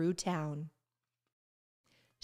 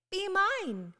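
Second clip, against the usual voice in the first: lightly voiced Ruby; 10 semitones higher than usual. Ruby's quote, pitched up.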